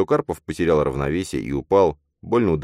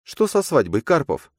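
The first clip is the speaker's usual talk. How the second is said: sharp demand; 11 semitones higher than usual. Acted. Nastya demanding about wedding.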